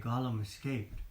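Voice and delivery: ordinary delivery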